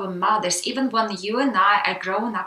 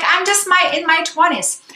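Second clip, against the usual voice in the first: much higher, louder indignant protest; protesting self; 9 semitones higher, 6 dB louder than usual. Retold or imitated. Young woman protesting to her mother that she's only in her twenties. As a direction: push back hard on her mother, high and loud. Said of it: "And I was like".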